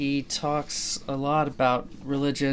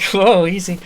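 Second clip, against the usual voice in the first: Whoa, easy called out much louder and higher; mock alarmed neighbor; 7 semitones higher, 13 dB louder, slower than usual. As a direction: warn him off the invisible dragon, hammed up. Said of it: "He's like".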